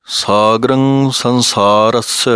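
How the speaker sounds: ਸਾਗਰੰ ਸੰਸਾਰਸ drawn out slowly, syllable by syllable; slow Gurbani diction demo; careful recitation. Shown as a demonstration. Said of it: "ਪਾਠ ਇਸ ਤਰ੍ਹਾਂ ਬੋਲਣਾ ਹੈ".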